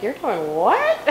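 mock disbelief, "what" swooping up high; incredulous. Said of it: "that raised eyebrow".

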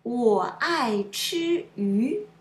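我爱吃鱼 slowly, every tone fully drawn; dip, fall, level, rise across 我爱吃鱼; careful tone demo. Shown as a demonstration. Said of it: "Let's see more example".

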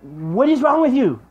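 high, loud and slow, dripping with disbelief; talking back to the video, slow and incredulous. Retold or imitated. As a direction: ask it like they've lost their mind. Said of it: "I'm l-, literally like".

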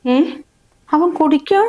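high-pitched, startled disbelief; shocked question. Acted. Mother learning her son-in-law drinks, shocked. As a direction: ask it in alarm, pitch jumping up.

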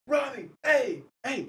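high, eager call across the gate; calling out excitedly. Retold or imitated. Spotting Rami walking out of the gate, high excited call. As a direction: hail him like an excited fan.

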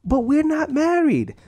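high, loud, slow mock protest; protesting girlfriend. Girlfriend objecting to divorce line, high mock protest. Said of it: "And if they say".

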